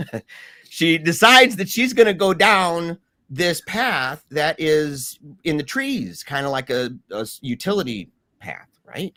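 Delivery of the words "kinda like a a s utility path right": ordinary talk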